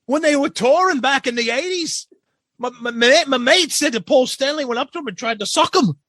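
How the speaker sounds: very high-pitched Aussie caricature; exaggerated, high mock Aussie accent; cartoonish Australian drunk. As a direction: tell it as a worked-up drunk Australian. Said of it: "he'd always talk like this".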